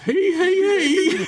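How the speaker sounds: high put-on cackle; laughed-out, high "hey hey"; mock Southerner's laugh. Shown as a demonstration. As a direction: demonstrate an exaggerated Southern laugh. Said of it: "That's how real Southerners laugh".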